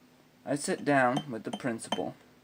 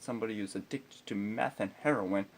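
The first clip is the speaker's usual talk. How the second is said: ordinary talk, nothing marked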